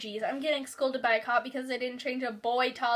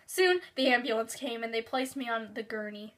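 usual speaking voice

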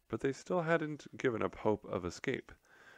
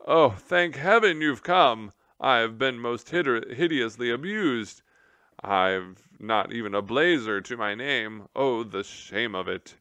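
a flustered military mouse begging for rescue; pompous general; 3 semitones higher, 7 dB louder than usual. Acted. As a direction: plead like an aggrieved officer.

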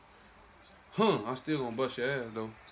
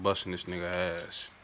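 ordinary talk

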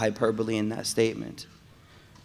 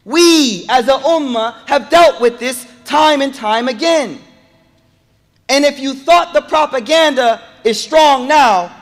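high, loud impassioned sermon; preaching at full force; 11 semitones higher, 11 dB louder than usual. Acted. Preacher rousing the congregation, high and loud. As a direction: drive it home to the crowd.

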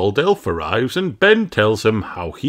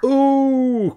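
excited ooh; 4 dB louder than usual. A narrator's reading. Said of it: "cry the fairies".

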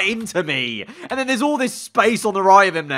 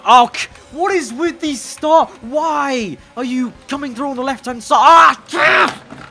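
exasperated; 7 semitones higher than usual. Real, not acted. Gamer at drivers cutting in at the start, exasperated. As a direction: demand answers, really annoyed. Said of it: "I'm getting so annoyed now".

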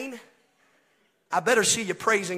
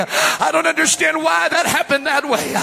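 high revival exhortation, building; fervent preaching; 6 semitones higher, 8 dB louder than usual. Real, not acted. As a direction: call the believers forward, climbing toward a cry.

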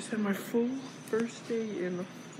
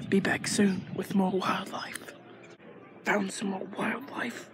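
slow hushed whisper like a nature host; documentary whisper; slower than usual. Pretend. Vlogger hosting a pretend wildlife show, hushed whisper. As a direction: whisper so you won't scare the animals.

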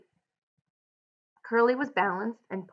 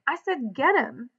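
higher, insistent narrated yell; 5 semitones higher than usual. A narrator's reading. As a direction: push the repeated order up high. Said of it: "George yelled again".

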